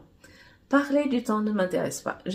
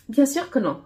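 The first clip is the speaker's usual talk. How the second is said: indignant, higher and louder; indignant retort; 7 semitones higher, 6 dB louder than usual. A narrator's reading. Man offended, retorting to Miss Univers, higher and louder. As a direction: snap back offended. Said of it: "répliquai-je".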